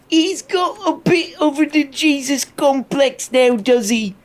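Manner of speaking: a British bloke teasing a mate; mock British scoff. Reactor mimicking Piers teasing Russell in a British accent. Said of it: "mocking voice".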